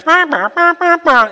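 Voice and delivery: high nonsense wah-wah babble; mock the droning cartoon teacher; Charlie Brown teacher. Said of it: "like the Charlie Brown cartoon".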